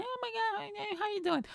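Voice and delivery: put-on high breathy-sounding girl, far above his own voice; girly voice. Comedian voicing a girl greeting a guy, high girly voice. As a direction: play the excited girl at the bar.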